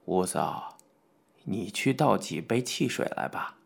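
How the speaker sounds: put-on low, soft old man's instruction; deep, hushed elderly voice; old gentleman. A narrator's reading. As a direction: quietly give a mild order as an elder. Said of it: "傅老爷子吩咐吴大娘道".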